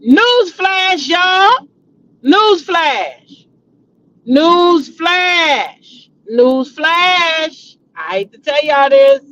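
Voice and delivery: announcing. Real, not acted. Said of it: "newsflash announcement".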